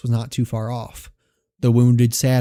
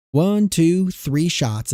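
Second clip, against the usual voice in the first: ordinary talk, nothing marked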